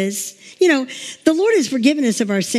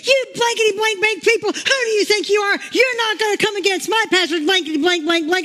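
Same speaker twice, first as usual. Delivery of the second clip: higher, retold tirade at the intruders; imitating a woman cussing out intruders who came to take over the church; mock cussing out; 8 semitones higher than usual. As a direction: play the churchwoman telling them off, hammed-up. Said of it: "she's cussing them out".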